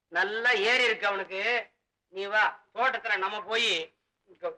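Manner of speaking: ordinary delivery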